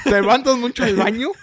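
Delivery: infomercial pitchman. Acted. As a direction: play the late-night ad announcer. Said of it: "there's some infomercials trying to sell you something".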